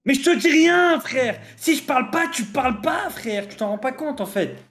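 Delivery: high, exasperated protest; heated. Real, not acted. Heated quarrel, pleading back.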